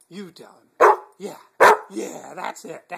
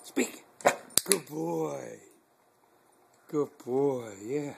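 usual speaking voice

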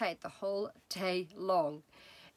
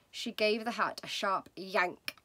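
plain reading voice

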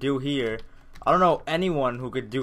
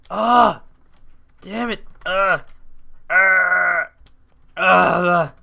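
loud, high yells of frustration; frustrated yells; 6 semitones higher, 11 dB louder, much slower than usual. Real, not acted. Gamer missing the same jump, yelling in frustration. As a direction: let out one raging shout after another.

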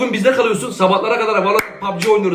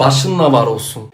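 ordinary talk, nothing marked

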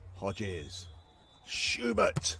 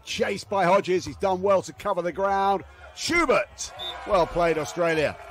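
raised, loud call that jumps up on Schubert; excited call; 5 semitones higher, 4 dB louder than usual. Real, not acted. Commentator praising the Australians, voice leaping up. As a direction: cheer the rally home.

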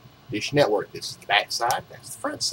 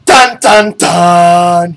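dramatic sting; 2 semitones higher, 11 dB louder, much slower than usual. Acted. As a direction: belt the dramatic movie sting.

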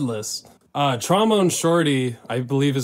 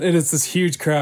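ordinary talk, nothing marked

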